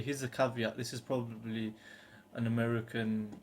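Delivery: ordinary delivery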